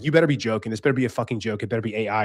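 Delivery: usual speaking voice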